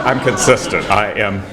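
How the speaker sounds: normal talking voice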